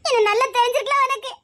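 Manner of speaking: laughing through tears; half laughing, half sobbing, with tender reproach; crying. Acted. To the long-lost boy she finds again, crying and laughing. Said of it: "அழாத".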